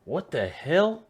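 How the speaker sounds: high-pitched, rising bewilderment; baffled disbelief. Real, not acted. Listener reacting to a puzzling dialect clip, baffled disbelief. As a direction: blurt it out, completely thrown.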